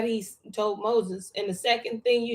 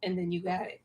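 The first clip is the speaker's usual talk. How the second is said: usual speaking voice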